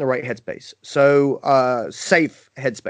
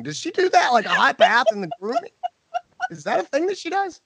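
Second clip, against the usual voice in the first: high, incredulous gossiping voice; whisper-gossip like scandalised staff; mock gossip; 12 semitones higher than usual. Techs gossip about the boss's bath.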